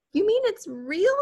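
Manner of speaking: very high, loud shocked scream; mother screaming at the news. A narrator's reading. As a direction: shriek it in disbelief. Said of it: "his mother screamed".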